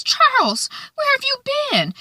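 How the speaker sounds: hammed-up high-pitched scolding; Quigley quote. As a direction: squeal it like a fussy brothel mistress. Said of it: "Quigley says".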